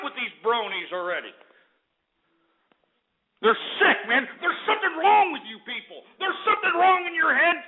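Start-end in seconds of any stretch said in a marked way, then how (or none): 3.4-7.6 high-pitched, indignant tirade; angry rant. Real, not acted. Host denouncing bronies among his listeners, angry rant. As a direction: rail at them, pitch climbing in outrage.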